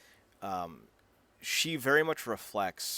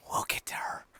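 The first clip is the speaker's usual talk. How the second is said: whisper. Acted. Teasing a later character.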